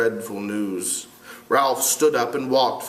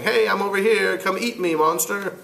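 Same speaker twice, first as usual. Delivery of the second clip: lightly raised, teasing shout to the beast; mock call to a monster; 8 semitones higher than usual. Teacher mocking Ralph, calling out to the beast. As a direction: call out to the monster as if offering yourself. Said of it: "That's like saying".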